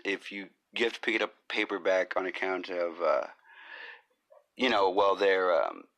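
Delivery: ordinary delivery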